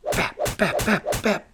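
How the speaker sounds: quick mimicked punch noises; imitated rapid jabs; punch sounds. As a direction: rattle off the jabs like blows landing.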